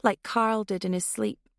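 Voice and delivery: plain reading voice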